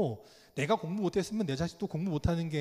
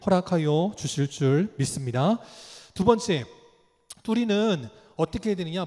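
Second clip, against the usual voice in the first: preaching at full force; 6 dB louder than usual. Acted. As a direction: declare it like a rising altar call. Preaching climax.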